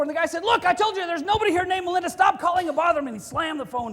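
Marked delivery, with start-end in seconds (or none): 0.4-3.1 fast, loud, higher yelling; furious shout. Retold or imitated. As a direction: yell at the caller to stop. Angry caller, second time.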